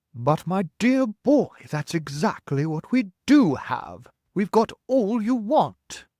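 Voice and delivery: put-on loud slow elderly voice; triumphant old grasshopper. Grasshopper telling James they have string, slow and loud. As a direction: savour the good news.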